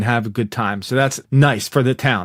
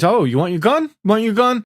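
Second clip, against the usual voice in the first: lightly taunting, pitch rising higher; mock taunting; 7 semitones higher than usual. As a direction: tease them with a dangled offer. Retold taunt over the gun.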